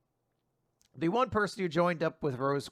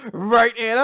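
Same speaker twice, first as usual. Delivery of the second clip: mock taunt; 6 semitones higher, 8 dB louder than usual. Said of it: "I just love".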